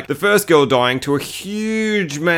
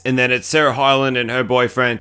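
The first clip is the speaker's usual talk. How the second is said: normal talking voice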